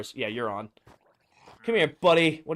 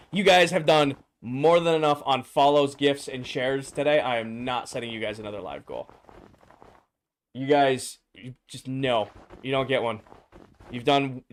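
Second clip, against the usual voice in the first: ordinary talk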